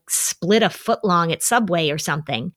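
ordinary talk, nothing marked

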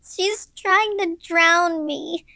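put-on very high, drawn-out little-girl cry; slow, high panicked wail; child's scream. A narrator's reading. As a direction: wail it as a terrified six-year-old. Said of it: "she screamed".

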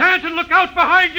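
sudden high warning yell; alarm shout. Acted.

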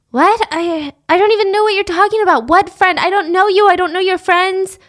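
high-pitched acted indignant denial; bewildered protest. Accused girl tells him she doesn't know his friends, protesting.

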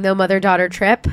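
normal talking voice

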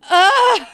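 loud, high mock groan of disgust. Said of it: "starts to throw this fit".

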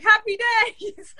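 high, stretched-out cheer; exclaimed catchphrase. Retold or imitated. Guest quotes the story she posted today, high drawn-out exclamation. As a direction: sing it out high and slow.

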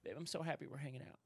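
soft, quick murmur to a partner; exhausted by happiness. Shown as a demonstration. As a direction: say it worn out with contentment. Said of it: "just so exhausted by happiness".

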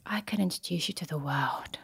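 soft, conspiratorial whisper; hushed offer. Retold or imitated. Influential neighbour offers introductions, hushed whisper. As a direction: promise it quietly, under the breath.

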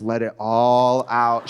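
low slow reassurance; calm the crying client slowly; soothing. Retold or imitated. Masseuse soothing the crying client, low and slow. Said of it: "She was like".

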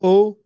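"oh" said high and loud; French AU sound. Shown as a demonstration. Cohost showing how French AU is pronounced, French pronunciation. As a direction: sound out "oh" as one pure French vowel. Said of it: "How do you pronounce it?".

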